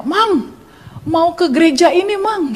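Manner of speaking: high-pitched appeal to a driver; calling out a question. Retold or imitated. Passenger asks minivan driver for the church, calling out. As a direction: hail him and ask the way. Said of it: "Saya tanya".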